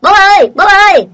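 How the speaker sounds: loud high call for dad; shouting. Acted. Boy running home shouting to his father, loud high call. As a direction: yell out to dad in alarm. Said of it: "chạy về hét to".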